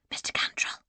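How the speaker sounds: breathless whisper of his name. Acted. Frightened lady whispering to Mister Cantrell at the cart. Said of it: "I whispered".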